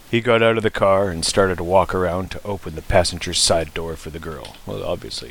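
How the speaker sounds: usual speaking voice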